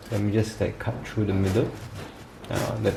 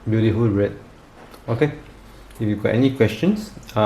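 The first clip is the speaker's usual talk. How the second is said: normal talking voice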